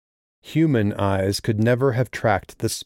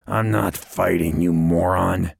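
ordinary delivery

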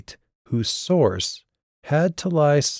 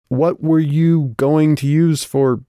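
slow, higher, deliberate question; raised, drawn-out query; careful restart; 7 semitones higher, 4 dB louder, slower than usual. A narrator's reading. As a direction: spell it out slowly, word by word. Calliope carefully restarts her question.

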